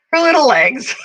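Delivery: high cooing. Real, not acted. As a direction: coo it up high and delighted. Cooing repeated, second higher.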